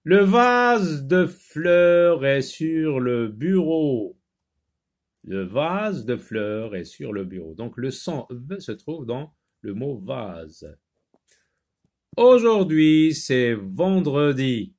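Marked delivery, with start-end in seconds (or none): 0.0-4.2 very slow, loud, higher-pitched classroom reading; model reading aloud. Shown as a demonstration. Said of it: "Lis les phrases". 12.2-14.7 slow, drawn-out sentence starting high and loud; model reading aloud. Shown as a demonstration. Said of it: "Lis les phrases".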